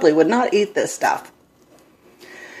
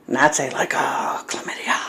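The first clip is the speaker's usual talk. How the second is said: hushed, breathless whisper; secretive whisper of an awkward diagnosis; embarrassed whisper; 8 semitones lower, 9 dB quieter than usual. Retold or imitated. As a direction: whisper it so the waiting room can't hear. Embarrassed confession in crowded office.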